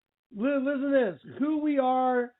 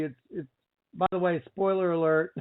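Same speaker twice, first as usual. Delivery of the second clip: ordinary talk, nothing marked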